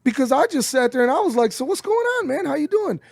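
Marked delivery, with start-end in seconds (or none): 1.5-3.0 bright high friendly hello; friendly greeting. Retold or imitated. Greeting a homeless veteran warmly, high and friendly. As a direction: greet him like an old buddy, pitched up.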